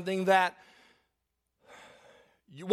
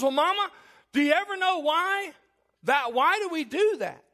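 lightly voiced daughter, pitched higher; curious daughter; 6 semitones higher than usual. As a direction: ask Mama in a higher, puzzled voice. Daughter voiced questioning her mother.

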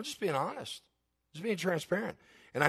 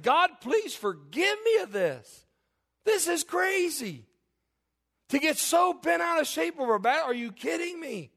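worked-up angry plea; 10 semitones higher, 3 dB louder than usual. Retold or imitated. Preacher reliving his prayer at a basketball game, high and exasperated. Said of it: "I'm getting angry".

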